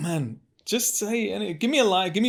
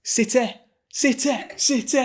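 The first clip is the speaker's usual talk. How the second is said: high, louder Manchester football chant; Manchester accent; 11 semitones higher, 3 dB louder than usual. Shown as a demonstration. Guest does Manchester accent on request. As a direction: chant City like a Manc fan. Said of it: "Take us to Manchester".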